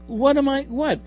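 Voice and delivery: high, puzzled cop voice, pitched well up; mock bewildered policeman; baffled cop. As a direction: play the confused cop arriving on scene. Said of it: "the cop shows up and says".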